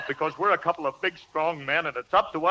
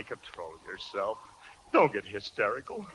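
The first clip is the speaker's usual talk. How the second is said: low, soft "Don't get hysterical"; controlled calming; 9 semitones lower, 9 dB quieter than usual. Acted. First, controlled calming of wife.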